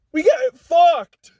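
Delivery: high, loud exasperated yelling; outraged shout. Real, not acted. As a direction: yell it out in disbelief. Venting outrage at the punishment.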